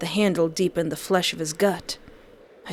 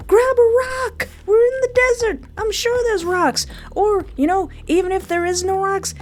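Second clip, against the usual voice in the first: frantic urging; 10 semitones higher than usual. Real, not acted. Streamer yelling at his character to finish the enemy, frantic. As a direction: urge the hero on, frantically.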